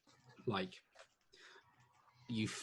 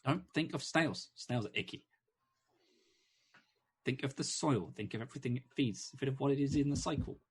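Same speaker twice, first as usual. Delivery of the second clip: higher, louder little bird urging; hummingbird voice; 5 semitones higher, 5 dB louder than usual. Acted. Hummingbird coaching the dwarf to think of soil, high and eager. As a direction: chirp the instructions, quick and insistent.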